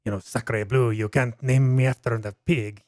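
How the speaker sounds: lightly put-on French voice; a hint of outraged Frenchman; French accent. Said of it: "that's a terrible French thing".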